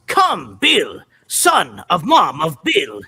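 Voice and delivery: slow, loud barbarian hero declaration; Fangbone voice. Shown as a demonstration. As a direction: proclaim it like a cartoon warrior. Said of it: "one of the things he says in the first episode".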